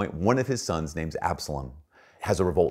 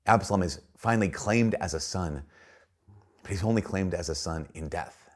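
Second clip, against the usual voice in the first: ordinary talk, nothing marked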